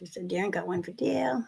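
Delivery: muttered list, nearly silent; hushed whisper; whispered aside. Real, not acted. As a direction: whisper to himself while tallying. Reading names under breath.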